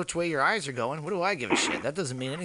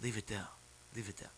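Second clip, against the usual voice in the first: very soft and low; hushed retold plea; 7 semitones lower, 19 dB quieter, faster than usual. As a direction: murmur it, as if stunned. Retelling his shaken, hushed reply.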